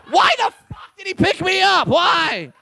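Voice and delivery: high, indignant shouting; exasperated outrage. Acted. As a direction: demand an answer in comic disbelief. Acting outrage at being picked up.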